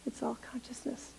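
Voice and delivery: soft whispered echo. Real, not acted. Minister repeating the point to the congregation, whispered. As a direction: let it fall to a whisper.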